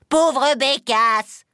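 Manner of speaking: loud, drawn-out scornful cry; exasperated old witch, high and loud; witch's exclamation. A narrator's reading. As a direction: exclaim in exasperation, stretching both words. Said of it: "s'écria la vieille".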